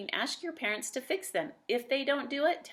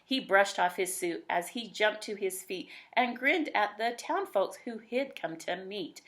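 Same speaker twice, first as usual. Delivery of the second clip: lilting rhymed read-aloud; bouncy rhyming narrator voice; storybook narration. A narrator's reading. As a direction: tell it like a bedtime picture book.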